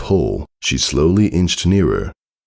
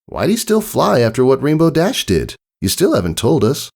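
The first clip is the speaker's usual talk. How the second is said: high curious filly voice; a prodding little filly; young filly voice; 10 semitones higher than usual. Acted. Filly character asking.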